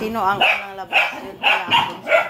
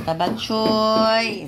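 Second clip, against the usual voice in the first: drawn-out call; 4 semitones higher, 3 dB louder, much slower than usual. Real, not acted. Owner calling her pet, long drawn-out name. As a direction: stretch the name out slowly, sing-song.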